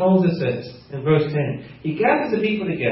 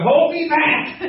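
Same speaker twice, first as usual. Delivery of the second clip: high, hammed-up parental outrage; comic fury breaking into laughter; mock outraged parent; 10 semitones higher, slower than usual. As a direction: play a parent ready to fight, for laughs.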